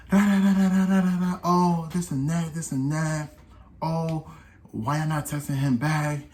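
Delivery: high-pitched mocking yapping; mimicked nagging girlfriend.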